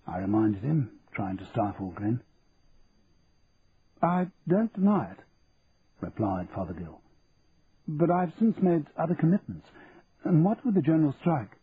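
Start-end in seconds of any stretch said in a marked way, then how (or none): 4.0-11.6 fussy auctioneer, pitched up; a prim, businesslike auctioneer; lightly raised auctioneer voice; Fothergill. A narrator's reading.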